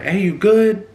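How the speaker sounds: high, loud call to another room. Retold or imitated. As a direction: call out from the bedroom. Calling out to wife.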